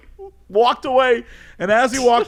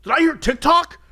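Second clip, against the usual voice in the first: excited stranger; 10 semitones higher than usual. Retold or imitated. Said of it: "a random person goes".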